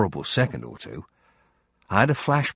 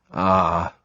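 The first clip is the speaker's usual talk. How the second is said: ominous ah; 4 dB louder than usual. Acted. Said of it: "the way I said it was sufficiently ominous".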